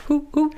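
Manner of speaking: high, hooted owl call; playful high-pitched owl imitation. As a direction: hoot like an owl. Imitates an owl after "owl man.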